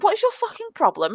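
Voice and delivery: mock angry outburst at teammate; lost it. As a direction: snap back, fed up. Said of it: "lost it".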